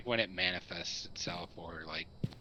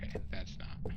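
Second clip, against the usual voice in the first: whispering. Real, not acted. Whispers during ghost hunt.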